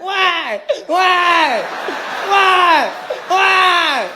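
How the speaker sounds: put-on high, loud whiny baby wail; whining baby cry. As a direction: wail "Why?" like a spoiled baby.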